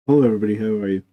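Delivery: ordinary delivery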